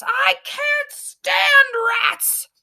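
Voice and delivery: very high, very slow squeal; panicked wail. A narrator's reading. Coachman squeals in terror about the rat. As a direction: whine in pure terror. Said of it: "he shouts".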